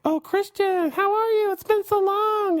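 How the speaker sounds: very high, drawn-out mock falsetto greeting; gushing acquaintance. Old girls from high school greeting him, gushing mock falsetto. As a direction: squeal it in a hammed-up falsetto, stretching the end.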